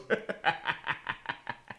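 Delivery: breathy, unvoiced chuckle; real wheezy laugh. Real, not acted. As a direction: laugh at your own bad joke. Laughs at own bad accent.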